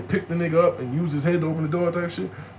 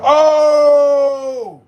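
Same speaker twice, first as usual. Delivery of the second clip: long, loud, high "oh"; acted shocked yell; 11 semitones higher, 11 dB louder than usual. Stabbed man seeing blood squirt, loud shocked cry.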